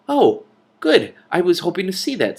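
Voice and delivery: high, bright eager gush; thrilled at the chance to see it; eager delight. Acted. Simrine eager to see the king's cave, excitedly.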